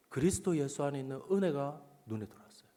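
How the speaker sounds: normal talking voice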